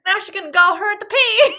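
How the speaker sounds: laughing country farmer bit; old farmer. Pretend. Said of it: "when I talk like a farmer".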